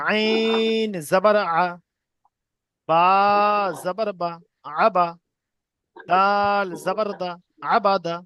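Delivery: slow drill with long-held letter names; deliberate, chant-like spelling aloud; spelling piece by piece. Shown as a demonstration. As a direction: stretch each letter, pause, then blend the syllables. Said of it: "কেটে কেটে বানান করবো".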